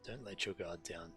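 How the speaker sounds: ordinary delivery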